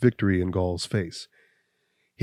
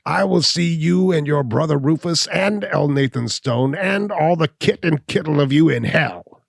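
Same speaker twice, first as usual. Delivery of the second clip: much higher and louder curse; furious cry; 8 semitones higher, 6 dB louder than usual. Acted. Cornered hunchback cursing Abner, much higher and louder. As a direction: scream the curse at all of them. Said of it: "cried the hunchback".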